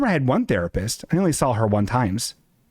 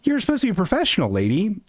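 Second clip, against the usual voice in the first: high, fast mock scold; scolding retort; 9 semitones higher than usual. Streamer scolding flaky therapist, high fast mock scold. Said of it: "And I'm like".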